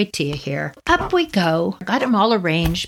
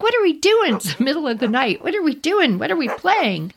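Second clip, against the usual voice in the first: excited dog's voice, pitched up; Becky's voice; 7 semitones higher than usual. Retold or imitated. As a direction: imitate an eager dog, bouncy high questions. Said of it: "Becky's like".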